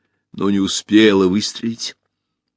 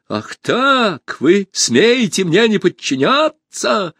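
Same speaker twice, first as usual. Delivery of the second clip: high, slow, stretched indignation; outraged threat; 12 semitones higher, 4 dB louder, slower than usual. A narrator's reading. Usurper defied by the hall, high outraged demand. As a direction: rise high in affronted fury.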